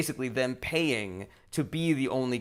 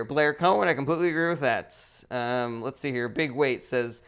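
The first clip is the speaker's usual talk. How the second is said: ordinary talk, nothing marked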